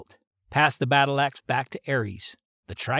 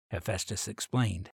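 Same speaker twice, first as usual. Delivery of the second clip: ordinary delivery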